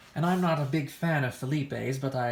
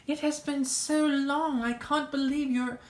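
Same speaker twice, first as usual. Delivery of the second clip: gushing greeting; 11 semitones higher, slower than usual. Acted. Gushing reunion with Chastity.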